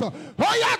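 loud, high shouted command, stretched out; fierce preacher's shout, pausing between commands; full-force deliverance command. Real, not acted. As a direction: drive it out with drawn-out shouts. Commands demons out in prayer.

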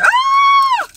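very high, loud delighted scream; excited scream. Real, not acted. Collector finds the pig in the box, excited scream. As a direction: shriek with real joy. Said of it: "it's probably 'cause I'm screaming".

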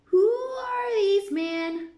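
high, loud, slow queen voice; demanding queen. A narrator's reading. Queen asks about gardeners, demanding. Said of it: "she asked".

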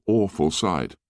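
ordinary delivery

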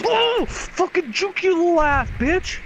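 high, slow gloating taunt; excited trash talk. Real, not acted. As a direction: crow over the kill. Trash talk after a kill.

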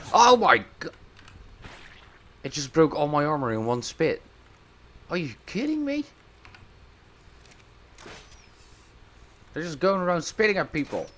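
high, loud shocked outburst; outraged disbelief. Real, not acted. Gamer losing all armor, high shocked outcry. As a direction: cry out in disbelief at the broken armor. Armor broken by one spit.

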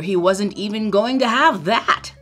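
plain reading voice

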